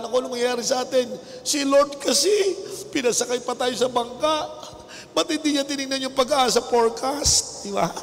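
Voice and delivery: mock panic. Voicing the worried disciples.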